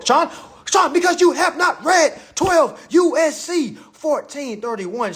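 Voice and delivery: high, emphatic telling-off; exasperated scolding. Real, not acted. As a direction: call him out, pitched high and insistent. Scolding Sean for not reading.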